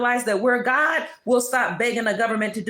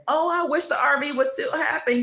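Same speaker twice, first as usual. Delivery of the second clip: mock wishful whining; 7 semitones higher than usual. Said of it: "You're still begging".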